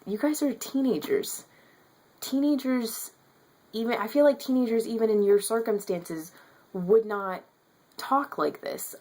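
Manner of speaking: high-pitched exasperated protest. Retold or imitated. Acted exasperated reply to book's teens.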